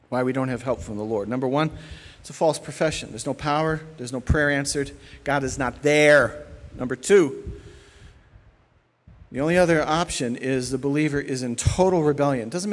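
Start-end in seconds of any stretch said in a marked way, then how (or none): none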